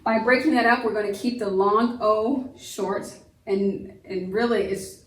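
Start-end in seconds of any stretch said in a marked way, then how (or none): none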